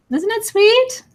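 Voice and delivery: high, loud delighted squeal; a real gush of delight. Real, not acted. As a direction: coo it out with delight. Gushing over viewer comment.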